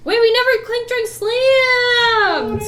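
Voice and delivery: loud, high, excited cheer; excited shout. Real, not acted. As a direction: shout it out to the group. Calling for a toast.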